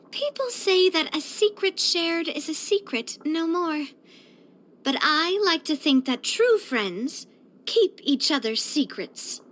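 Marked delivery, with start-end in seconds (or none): none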